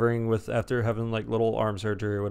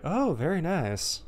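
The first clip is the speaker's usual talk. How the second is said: slow, higher, swooping appreciation; mock impressed approval; 6 semitones higher, slower than usual. Boss approving what he was shown, mock impressed. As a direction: draw it out, slyly impressed. Boss's sly approval acted.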